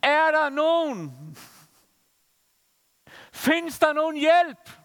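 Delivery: high, slow mock cry into emptiness; calling for help from a lone field. Sick woman crying out in a field, slow and high. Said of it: "Og råber bare".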